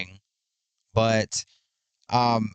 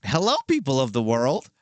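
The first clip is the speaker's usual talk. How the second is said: catchphrase delivery; 3 semitones higher than usual. Shown as a demonstration. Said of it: "my favorite quote".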